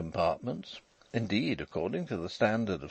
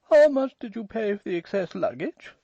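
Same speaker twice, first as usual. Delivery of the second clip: put-on high, louder young woman's voice; 12 semitones higher, 6 dB louder than usual. A narrator's reading. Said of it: "an untidy young woman asked".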